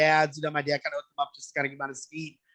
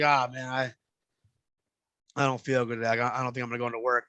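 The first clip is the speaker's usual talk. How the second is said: lower, fast fake-sick mumble; mock sick voice; 4 semitones lower than usual. Roommate faking sickness to skip work, mock sick voice. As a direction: play up feeling ill. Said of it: "So I was like".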